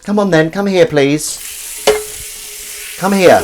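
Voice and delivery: high, loud coaxing call; calling a pet over. Real, not acted. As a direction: call the cat to come. Calling the cat to come.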